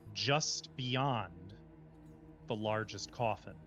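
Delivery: slow, measured dungeon description; scene setting. A narrator's reading. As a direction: paint the room for the players. Game master's scene setting.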